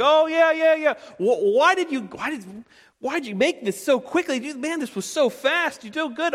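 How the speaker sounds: very high-pitched, hammed-up voice; squeaky mock amazement, played for laughs; mock amazed father. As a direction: parody an astonished dad in falsetto. Mocking re-enactment of father's surprise.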